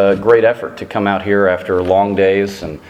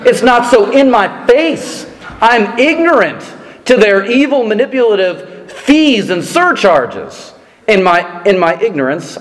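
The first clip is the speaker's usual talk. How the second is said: mock outrage; 10 semitones higher than usual. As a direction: rant like a tourist robbed of five cents. Comic indignant rant, high pitched.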